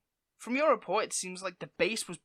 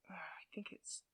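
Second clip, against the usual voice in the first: whisper; 17 dB quieter than usual. Real, not acted. Searching for lost script.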